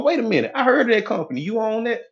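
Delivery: high, quick, amazed recognition; impressed surprise. Acted. Former doubter finds out you own the company, amazed and high.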